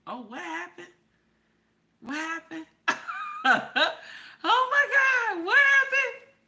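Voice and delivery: high, breathy gasping alarm; hammed-up dismay at the comment; mock shock. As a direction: play up the shock at the chat. Mock shocked reaction to chat.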